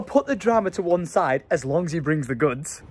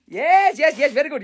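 delighted; 9 semitones higher, 3 dB louder than usual. Real, not acted. Admiring hoodie.

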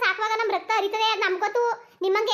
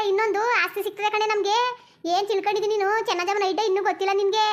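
ordinary delivery

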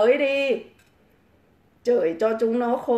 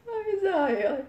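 high breathless laugh into the words; 9 semitones higher, 5 dB quieter, faster than usual. Real, not acted. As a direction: laugh it out, almost crying. Laughs heartily. (cười chảy nước mắt)

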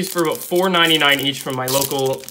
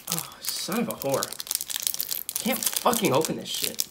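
frustrated mutter; 9 dB quieter, slower than usual. Real, not acted. Struggling to open pack.

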